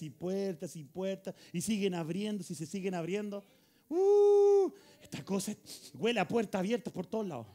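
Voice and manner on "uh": long, loud, high-pitched hoot; sustained "woo" of amazement; exultant whoop. Real, not acted. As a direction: let out a triumphant whoop. Exulting over doors opening.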